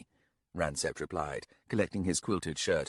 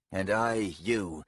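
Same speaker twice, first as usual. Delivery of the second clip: ordinary delivery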